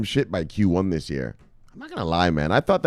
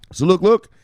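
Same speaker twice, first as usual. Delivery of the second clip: high, loud urging; excited call; 10 semitones higher, 7 dB louder than usual. Real, not acted. Streamer pointing viewers to the article, excited call. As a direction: call out high and punchy for attention.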